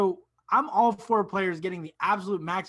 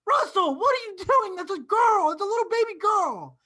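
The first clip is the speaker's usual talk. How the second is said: high, louder incredulous scolding; mock shocked scolding; 14 semitones higher, 5 dB louder than usual. Co-host as bystander scolding Westbrook, high mock disbelief. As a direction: scold him in disbelief, hammed-up.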